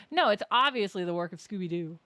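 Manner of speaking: loud mocking aside; sarcastic quip. Real, not acted. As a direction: toss it off with sarcasm.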